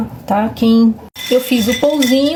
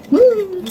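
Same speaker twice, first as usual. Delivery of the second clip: savoring mmm; 10 semitones higher than usual. Real, not acted. Tasting the fresh bread.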